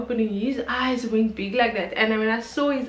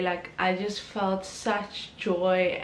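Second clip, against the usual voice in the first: ordinary talk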